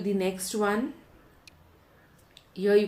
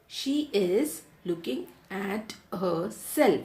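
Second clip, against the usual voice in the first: word by word, for writing down; measured classroom dictation with clear gaps; slow dictation. Shown as a demonstration. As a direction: dictate slowly to a class, spacing each word. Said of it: "Students, just write".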